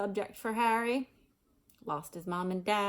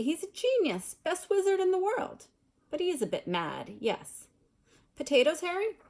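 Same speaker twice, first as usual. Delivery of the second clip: airily; 8 semitones higher, 4 dB louder than usual. Acted. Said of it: "said Percy airily".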